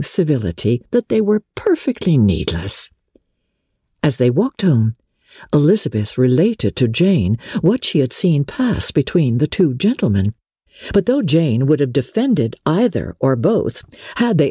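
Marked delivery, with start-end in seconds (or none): none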